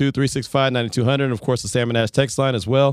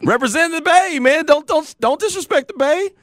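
hyped up; 12 semitones higher than usual. Real, not acted. Hyped about the Bay.

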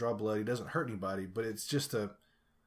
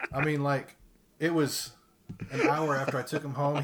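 ordinary delivery

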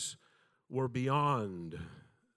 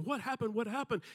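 put-on high, breathless child's voice, much higher; a little boy begging to know what happened; frantic kid; 12 semitones higher than usual. Frantic twin asking his brother.

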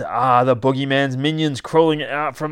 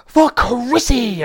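high, loud, drawn-out war cry; battle cry; 13 semitones higher, 8 dB louder, much slower than usual. Acted. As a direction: charge into battle yelling it long.

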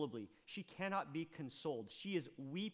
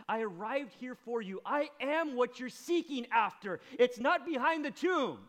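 rising, louder voice of Jesus; preacher voicing Jesus, higher and louder; 6 semitones higher, 7 dB louder than usual. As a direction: proclaim it as Jesus, building up.

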